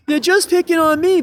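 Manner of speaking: put-on high, loud whine of a picked-on kid; mock the whiner, pitching way up; hammed-up complaint that they pick on him; whiny victim voice. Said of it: "I'm really not trying to be like".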